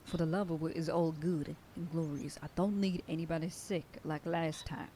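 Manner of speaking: usual speaking voice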